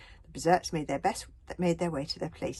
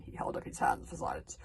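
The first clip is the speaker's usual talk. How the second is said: ordinary delivery, nothing marked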